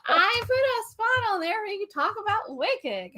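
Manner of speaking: high-pitched insistent protest; exasperated, teasing reminder; playful exasperation. Real, not acted. Playful squabble over the agenda.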